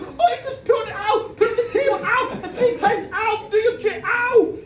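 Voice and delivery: high, loud, frantic pleading; yelping Mike. Acted. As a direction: cry out while getting smacked. Mike yelping while beaten.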